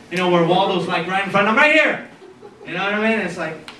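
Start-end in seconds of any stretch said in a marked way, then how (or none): 1.5-2.1 high, louder Waldo shout; mock call-out. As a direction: pop up and announce yourself. Waldo calling out.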